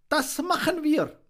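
high, altered bird voice; a proud little bird agrees; bullfinch voice. Acted. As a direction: bullfinch promising the monkey help. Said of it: "sagte der Dompfaff".

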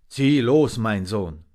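loud, rough send-off; rough raven voice. A narrator's reading. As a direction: send him off gruffly. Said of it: "sagte der Rabe rau".

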